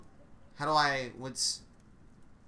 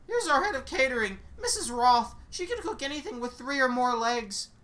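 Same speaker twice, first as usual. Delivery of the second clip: raised, brisk know-it-all guide; tour guide voice; 7 semitones higher, 3 dB louder than usual. Acted. Guide explaining the strange school to the new witch, brisk and raised. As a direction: rattle off the lore like a cheery guide.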